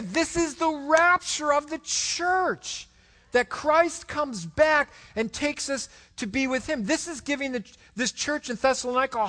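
fervent preacher's climax; preaching at full force. Acted. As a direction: lift the room with the promise.

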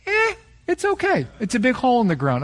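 high, dismissive shrug of a voice; bored kid. Retold or imitated. Preacher imitating his unimpressed kids, high and dismissive. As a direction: brush it off like a bored teenager. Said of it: "And for them, like".

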